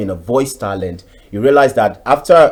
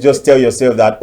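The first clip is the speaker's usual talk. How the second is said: normal talking voice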